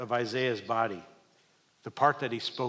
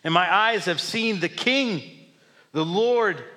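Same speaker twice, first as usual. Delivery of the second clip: awed cry; 7 semitones higher, 7 dB louder than usual. Acted. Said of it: "I cried".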